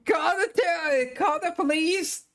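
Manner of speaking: high, hammed-up terrified yelling; mock panicked shriek. Passerby fleeing and calling police, high mock panic. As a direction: shriek for help in mock terror. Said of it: "He's like".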